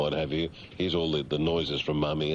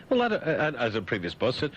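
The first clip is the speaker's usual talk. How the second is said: ordinary talk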